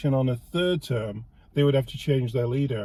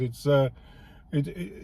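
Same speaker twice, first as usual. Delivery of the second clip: normal talking voice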